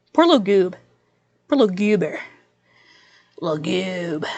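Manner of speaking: soft doting coo fading to a hush; cooing baby talk. Pretend. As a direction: coo at it like a pet.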